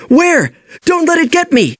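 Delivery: high, panicky little squeak; nervous tiny voice. A narrator's reading. Hidden little fish panics at hearing of a ghostfish, high nervous squeak. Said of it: "a tiny voice said nervously".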